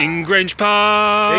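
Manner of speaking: loud and slow, hammed-up; theatrical announcement; mock proclamation. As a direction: proclaim the place name grandly.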